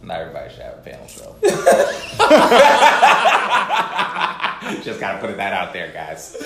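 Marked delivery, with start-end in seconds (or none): 1.4-4.8 big loud laughter. Real, not acted. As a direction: throw back a big laugh. Burst of laughter.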